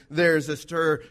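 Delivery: normal talking voice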